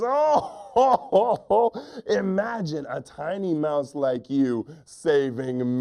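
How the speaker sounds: big acted ho-ho laugh into slow words; laughing lion. Lion scoffing at a tiny mouse, booming mock laughter. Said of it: "lion starts to laugh".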